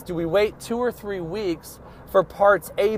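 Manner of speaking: ordinary delivery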